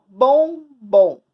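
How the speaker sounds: "bom, bom" as two loud separated beats; syllable by syllable. Shown as a demonstration. Teacher demonstrating syllable division, "bom, bom" loudly split. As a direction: punch each "bom" with a gap between. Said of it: "Como é que eu separo?".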